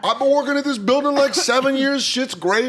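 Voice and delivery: high, quick, hammed-up buddy voice; excited catching-up. Former busser catching up with his old waiter, excited. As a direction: reel it off high and thrilled, imitating an old pal.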